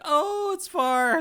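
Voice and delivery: high, drawn-out mock voice of a local lady; imitating locals. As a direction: squeak it out slowly like the amazed locals. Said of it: "They're like".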